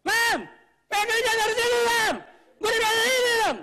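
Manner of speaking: high, very loud exasperated yell; shouting at wife. Pretend. Husband quarrelling over a misplaced alarm clock, shouting. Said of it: "Saya bisa ngomong".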